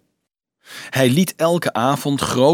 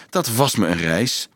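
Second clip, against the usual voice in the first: plain reading voice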